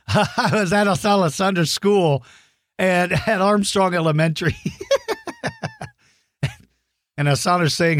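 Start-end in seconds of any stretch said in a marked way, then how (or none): 0.1-4.6 talking through giggles at a memory; laughing while talking. Real, not acted. Recalling funny school visit. 4.6-5.9 a real burst of high laughter. Real, not acted. Laughs at his own story.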